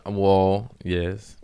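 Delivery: ordinary talk, nothing marked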